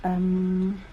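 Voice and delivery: ordinary delivery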